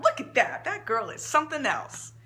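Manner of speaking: happy mama chuckling after a good reading; laughing. Acted. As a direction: laugh it out, pleased. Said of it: "clapped her hands twice and laughed".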